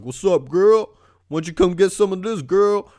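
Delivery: imitation of hoodlums hitting on a passerby; catcalling hoodlum.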